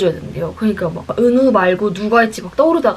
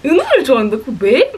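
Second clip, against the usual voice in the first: hammed-up incredulous question; overreacting surprise; 3 semitones higher than usual. Girl overreacts to friend's crush, exaggerated surprise. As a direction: overdo the shock, imitating yourself. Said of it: "괜히 막 과잉 반응하면서".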